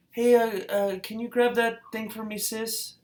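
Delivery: high, breezy mom voice; imitating a mom. Mom asking her nine-year-old to grab something, high and breezy. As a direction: toss off a casual request, pitched high. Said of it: "She's like".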